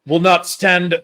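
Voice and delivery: put-on a rough try at a Northern English accent, flat vowels; hammed-up Northern English lord refusing. Podcast host attempts a Northern English accent for friends. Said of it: "I'm gonna try to use the Northern accent".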